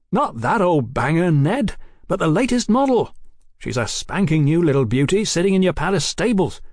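put-on high, excited young man's voice; boastful young brother. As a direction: brag breathlessly fast about your new machine.

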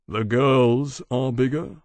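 slow, drawn-out musing; reminiscent. A narrator's reading. Requiescat recalling the bigger females, reminiscent. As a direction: linger over it as a memory. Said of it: "with rather a reminiscent tone".

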